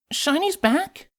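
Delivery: high, slow hopeful delight; light up with happy hope; brightening up. Acted. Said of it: "Cadance brightened up".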